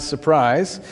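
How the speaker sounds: normal talking voice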